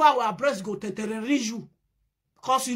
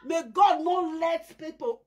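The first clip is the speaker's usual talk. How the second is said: ordinary delivery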